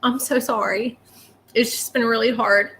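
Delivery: real tearful apology, very soft and halting; crying. Real, not acted. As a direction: choke out the words through tears, long breaks between. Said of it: "for crying and acting a fool".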